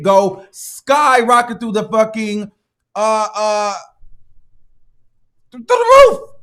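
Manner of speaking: furious at the fine going up; building rant. Real, not acted. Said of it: "building rant about ticket".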